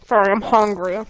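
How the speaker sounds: ordinary talk, nothing marked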